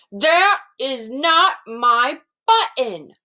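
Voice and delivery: shouted. Acted. Toad shouting at Frog over another wrong button. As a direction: spit each word out in frustration. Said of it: "shouted Toad".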